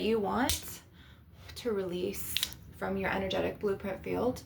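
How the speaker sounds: soft, spaced-out guided meditation voice; hushed healer leading a visualization. Acted. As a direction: guide them gently through a release, pausing between phrases. Leads an energy release meditation.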